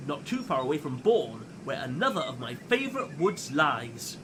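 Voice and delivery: usual speaking voice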